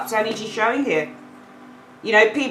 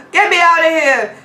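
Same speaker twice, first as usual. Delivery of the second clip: trapped woman pleading; 8 semitones higher, 6 dB louder than usual. Acted. Card woman begging to escape.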